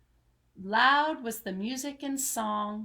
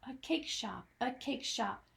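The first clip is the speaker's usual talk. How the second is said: fading echo repeat; 8 dB quieter than usual. A narrator's reading.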